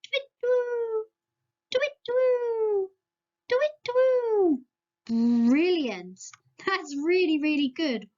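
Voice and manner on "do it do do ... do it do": high owl hoot, drawn out slower each time. Shown as a demonstration. Teacher showing kids an owl call, high and slow. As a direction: hoot like an owl, stretching it more and more. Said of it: "Can you do an owl noise?".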